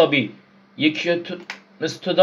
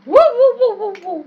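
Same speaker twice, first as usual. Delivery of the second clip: high excited woo-woo hoots; high hooting; 6 dB louder than usual. Real, not acted. Gamer hoots as he climbs. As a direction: hoot up high like a cheer.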